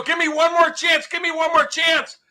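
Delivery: high-pitched repeated plea; pleading. Acted. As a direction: plead urgently for another try. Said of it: "They go, they go".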